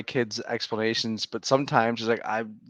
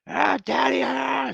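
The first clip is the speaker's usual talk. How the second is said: put-on high whiny child wailing for daddy; mock kid scream; 14 semitones higher, slower than usual. Dad retelling his toddler's meltdown, high whiny wail. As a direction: imitate a toddler melting down, pitched way up. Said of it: "screaming at me all you want".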